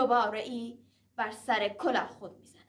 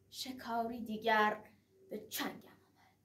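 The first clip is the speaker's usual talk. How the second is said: hushed menacing aside; said to himself; 3 semitones lower, 10 dB quieter than usual. Acted. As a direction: mutter it under the breath. (زیر لب و آهسته)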